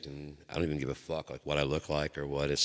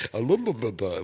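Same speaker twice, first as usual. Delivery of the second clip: high babble through laughter; laughing baby talk; 7 semitones higher, 7 dB louder than usual. Retold or imitated. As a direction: repeat her babble while cracking up. Repeating daughter's babble laughing.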